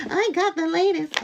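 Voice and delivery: high, bragging mock owner voice; lightly voiced smug buyer; mock proud owner. Mocking a Cybertruck owner, a high smug brag.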